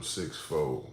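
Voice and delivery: normal talking voice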